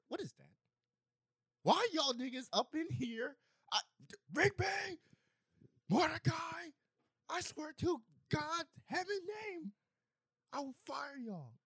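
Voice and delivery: high strained half-whispered yell; whisper screaming. Retold or imitated. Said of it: "you were whisper screaming".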